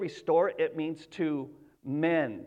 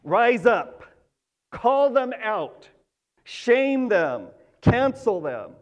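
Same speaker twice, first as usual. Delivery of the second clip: louder, higher rallying call; the world demanding shame, said louder; worldly outcry; 3 semitones higher, 6 dB louder, slower than usual. Retold or imitated. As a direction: whip up a crowd to cancel someone. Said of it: "because the world would say".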